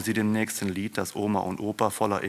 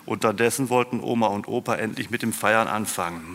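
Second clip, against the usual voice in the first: ordinary delivery, nothing marked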